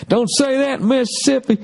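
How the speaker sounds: high squeaky mock Southern heckler; high mocking heckler impression.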